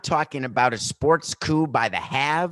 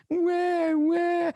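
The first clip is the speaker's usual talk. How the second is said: very high mock whimper; mocking baby cry; mock crybaby wah wah. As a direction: whine like a crybaby. Mocking the apology as crying.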